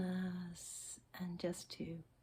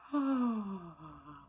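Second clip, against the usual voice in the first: 1 demonstrated sigh; 4 dB louder than usual. Shown as a demonstration. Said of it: "Sigh a little bit".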